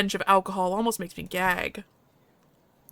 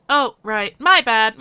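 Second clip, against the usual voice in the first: ordinary talk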